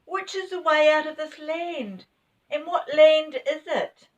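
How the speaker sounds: put-on boy yelling while grabbing a finger; shouting. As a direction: demand an answer over the song. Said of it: "shouted again".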